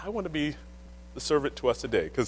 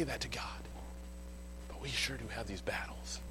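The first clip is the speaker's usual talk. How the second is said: hushed unvoiced aside; confiding whisper to the church; 6 semitones lower, 13 dB quieter than usual. Real, not acted. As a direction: lean in and whisper it. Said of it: "aside whispered to congregation".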